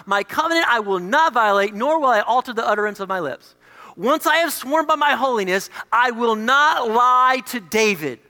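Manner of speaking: loud, emphatic scripture proclamation, slowing at the end. Acted. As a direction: declare it with full conviction. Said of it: "It seems pretty emphatic".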